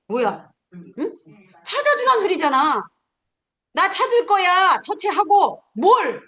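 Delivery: high, emphatic mock scolding; mock-angry. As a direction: drum it into learners with pretend anger. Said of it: "어르신들한테 막 화내듯이". (화내듯이 다그치기)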